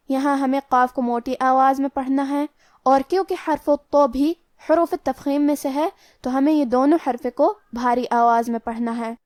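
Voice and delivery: ordinary talk, nothing marked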